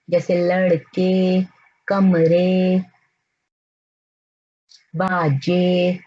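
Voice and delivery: slow, stretching the final ए in लड़के; slow e ending. Shown as a demonstration. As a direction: draw out the closing ए in कमरे and बाजे. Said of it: "वो ए की आवाज देता है". (धीरे, आखिर की ए लंबी खींचकर)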